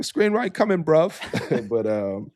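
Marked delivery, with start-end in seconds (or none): 0.0-1.1 mock British play call. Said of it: "you gotta say bruv".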